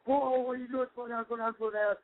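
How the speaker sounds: high, panicked mock yelp; freaking out. Woken passenger yelps at the speeding driver, high and panicked. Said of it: "I just wake up to him freaking out".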